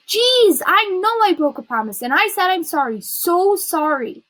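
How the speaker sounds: high, loud burst, last words drawn out; exasperated apology. A narrator's reading. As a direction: apologize while fed up with blame.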